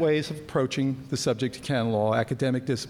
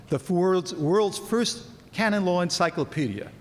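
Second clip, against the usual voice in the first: ordinary talk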